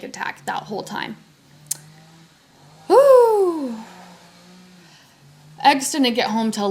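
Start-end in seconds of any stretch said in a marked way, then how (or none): 2.9-3.8 high, loud exhaled "whew". Real, not acted. After retelling her panic attack, loud whew. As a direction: blow out a high whoop of breath.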